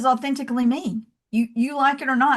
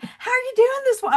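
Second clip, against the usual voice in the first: much higher, bubbly friendly question; cheery Southern greeting. Retold or imitated. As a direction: greet the café staff like old friends. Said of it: "and I was like".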